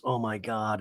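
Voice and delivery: low, subdued murmur; hushed dread. Retold or imitated. Tamra confiding in Emily at the side, hushed dread. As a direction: imitate a low, grave aside. Retold aside between two women.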